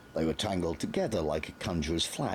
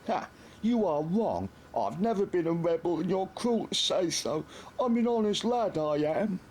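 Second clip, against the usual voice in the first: much higher, wailing lad near tears; tearful whine. A narrator's reading. Nobby to the Major, bursting into tears. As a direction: whimper it like a wronged kid.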